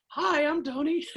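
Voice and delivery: put-on very high, squeaky kid greeting; boy's unbroken voice. Fifteen-year-old greeting the band's big guy, boyish squeak. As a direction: pipe up like a small boy. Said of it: "My voice had probably barely changed".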